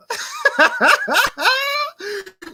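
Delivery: high, extended mocking cackle; laughing. Real, not acted. Laughing at Saints trying to buy success, high cackle. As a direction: crack up at the rival team. Laughs at rivals buying Super Bowl.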